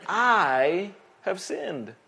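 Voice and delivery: very slow, each word weighed; slow solemn confession. Retold or imitated. David confessing to the Lord, slow and solemn. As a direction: confess it slowly and heavily. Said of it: "David said".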